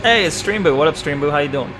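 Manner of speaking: ordinary delivery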